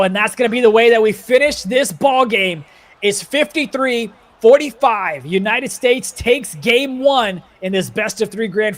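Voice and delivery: emphatic end-of-game commentary; play-by-play final call. Real, not acted. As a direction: call the final score big, drawing it out slowly. Live call of the game's final score.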